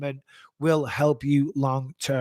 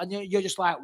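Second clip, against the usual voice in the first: ordinary talk, nothing marked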